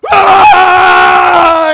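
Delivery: huge, sustained high yell; angry roar. Acted. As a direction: roar as an enraged Jesus storming in. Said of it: "not as little meek and mild Jesus".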